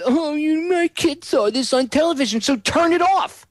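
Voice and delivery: high, whiny nagging-mom voice, way up top; mock soccer mom. Host mocking soccer moms who want TV off, whiny high voice. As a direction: parody a fussy soccer mom scolding. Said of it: "these goddamn soccer moms".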